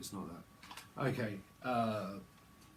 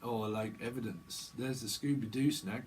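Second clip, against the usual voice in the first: ordinary talk, nothing marked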